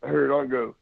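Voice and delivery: ordinary talk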